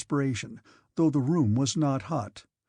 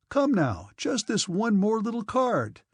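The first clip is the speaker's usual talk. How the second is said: cheerful voice; 7 semitones higher than usual. A narrator's reading. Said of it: "he said in a cheerful voice".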